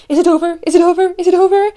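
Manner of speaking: high, loud frantic repeated question; urgent, squealed mock panic; panicked pleading. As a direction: re-enact desperate panic at full pitch. Acts out panic as ride ends.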